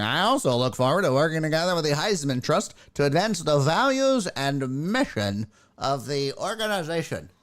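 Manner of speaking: stilted, over-formal spokesperson parody; mock PR statement. Host mocking Reggie Bush's prepared statement, stilted PR voice. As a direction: recite it like a canned corporate statement. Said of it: "P R people".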